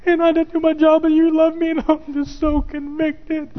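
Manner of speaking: husband quoting his wife in a high voice; wife's voice. Retold or imitated. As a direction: play the wife confessing, small and high. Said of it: "She goes".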